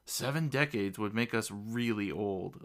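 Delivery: usual speaking voice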